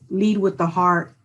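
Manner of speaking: normal talking voice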